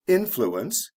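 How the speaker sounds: teacher stressing the start of influence; stress on the first syllable. Shown as a demonstration. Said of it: "the stress is on the first syllable".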